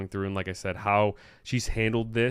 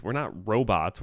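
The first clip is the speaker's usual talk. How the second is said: usual speaking voice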